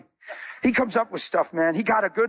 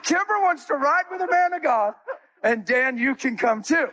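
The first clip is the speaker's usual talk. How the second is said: higher, teasing retelling of the friend; mimicked friend; 5 semitones higher than usual. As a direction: voice the friend's joke lightly. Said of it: "He said".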